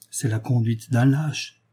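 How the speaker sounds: plain reading voice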